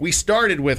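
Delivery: ordinary delivery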